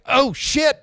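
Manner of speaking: sudden high, loud "Oh, shit"; alarmed outburst. Retold or imitated. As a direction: blurt it out as the fight breaks out. Seeing Trent attack the stranger.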